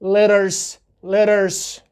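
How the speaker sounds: "letters" with a quick d for the t; American flap T. Shown as a demonstration. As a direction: repeat "letters" as LEDDERS. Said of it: "حرفی که قرار گرفت چی تلفظ".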